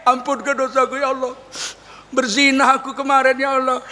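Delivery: pleading words that end in crazy laughter; acted unrepentant confession, laughing at the end; tearless mock prayer. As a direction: beg without crying, then cackle. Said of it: "nggak nangis, itu justru aneh".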